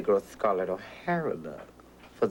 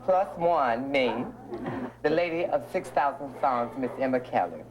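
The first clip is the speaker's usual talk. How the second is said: a higher emcee voice announcing the hostess; stage announcer; 5 semitones higher than usual. Acted. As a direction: present her like a showroom emcee.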